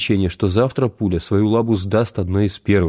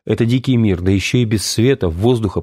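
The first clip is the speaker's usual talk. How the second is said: ordinary delivery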